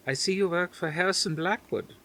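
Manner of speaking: a hint of a Dutch grandmother; faint Dutch accent. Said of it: "her faint Dutch accent".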